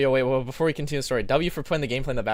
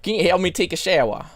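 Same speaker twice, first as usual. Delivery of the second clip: louder, hammed-up Southern twang; mock southern accent; 3 semitones higher, 5 dB louder than usual. Teasing the storyteller once more, hammed-up Southern accent. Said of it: "It's just funny accent".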